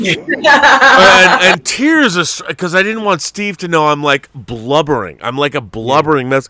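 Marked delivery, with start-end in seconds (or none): 0.5-2.5 slow, laughing words; laughing through it. Real, not acted. Retelling his sobbing at the film, laughing through the words. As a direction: laugh at yourself while you say it. Laughing while recalling tears.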